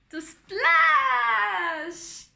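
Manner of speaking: high, loud, stretched splash; excited reveal. A narrator's reading. Storyteller answering own riddle, excited shout. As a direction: shout the answer like a big wave.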